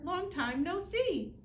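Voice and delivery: high, bright greeting; cheery hare. Acted. Hare greeting mouse in the cave, cheery. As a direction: greet an old friend as a hare. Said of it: "says Hare".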